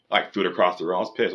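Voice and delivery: ordinary talk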